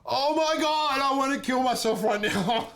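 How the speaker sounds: very high, laughing mock despair; mortified. MC mortified after the gaffe, high laughing mock despair. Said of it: "I'm like".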